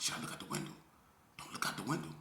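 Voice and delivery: frightened whisper to himself. Retold or imitated. Hiding from the gang outside: a scared whisper. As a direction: breathe the question without voice.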